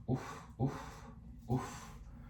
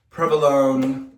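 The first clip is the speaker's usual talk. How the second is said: slow, loud, higher mock Jersey provolone; Jersey accent; 4 semitones higher, 5 dB louder, slower than usual. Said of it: "what's a Jersey accent?".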